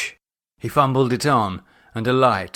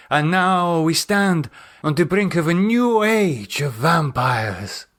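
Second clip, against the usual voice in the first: grand Miguel; 7 semitones higher than usual. Acted. Miguel toasting the vampires' new age, solemn.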